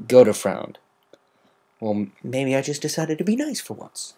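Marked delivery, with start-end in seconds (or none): 1.8-4.1 protesting. A narrator's reading. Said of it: "she protested".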